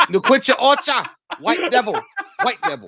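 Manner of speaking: high, loud mock warning; hammed-up high-pitched denunciation; mock outcry. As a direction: cry it out in hammed-up alarm.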